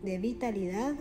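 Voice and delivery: guided meditation. Acted. Guide leading listeners through breathing and chakra visualization, calm meditation voice. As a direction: guide the listener gently into relaxation, unhurried.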